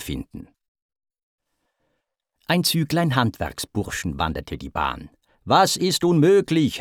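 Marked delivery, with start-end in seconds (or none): 5.5-6.8 high, raised, domineering question; mildly angry shouted challenge; imperious demand. A narrator's reading. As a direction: lord it over them. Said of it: "herrschte sie Poseidon an".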